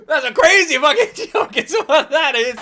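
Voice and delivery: loud laughing exclamation. Real, not acted. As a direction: laugh it out in delight. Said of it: "laughing reaction".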